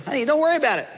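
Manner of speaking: high, breezy brush-off of her worries; dismissive husband. Retold or imitated. Husband waving off his upset wife, high breezy dismissal. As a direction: wave it away like it's nothing. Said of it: "you say".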